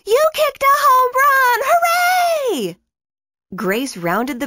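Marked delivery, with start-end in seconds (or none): 0.0-2.8 very high, loud joyful yell; excited yell. Acted. Teammates celebrating Grace's home run, yelling with joy. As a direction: cheer it to the whole field. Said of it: "yelled her teammates".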